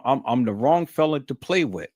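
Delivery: ordinary delivery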